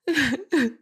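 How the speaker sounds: short laugh. Real, not acted. Laugh after pleading scene.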